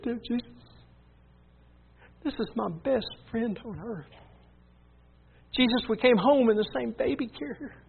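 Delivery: high, tearful pleading to Jesus; retold sobbing prayer. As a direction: act out begging through sobs for a friend's soul. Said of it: "I knew he was sobbing".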